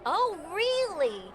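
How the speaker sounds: drawn-out surprised oh really; slow, high surprised question. Real, not acted. Surprised reaction.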